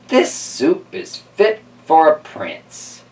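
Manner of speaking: put-on slow, high old lady voice; drawn out like a little old lady; slow old woman savouring the soup.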